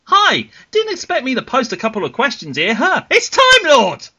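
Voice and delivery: high-pitched, hammed-up quoted greeting; Time Lord's greeting. Podcast host reads out a listener's message, hammed-up high voice. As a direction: build to a loud, high announcement. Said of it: "he starts off by saying".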